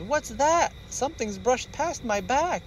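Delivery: high worried voice; a cub feeling something brush past; startled little bear. Acted.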